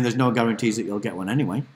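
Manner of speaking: ordinary delivery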